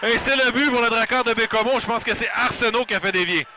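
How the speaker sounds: high, loud goal shout; excited sports commentary. Acted. Play-by-play goal call. (commentaire sportif excité)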